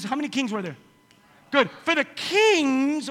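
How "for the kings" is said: very slow, stretched words; drawn out. Shown as a demonstration. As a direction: drag the words out. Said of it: "slowed repeat of quote".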